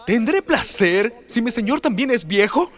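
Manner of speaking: high, slow musing question; Sara wondering. Acted. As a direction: savour the word placer slowly. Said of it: "Y ella dijo esto".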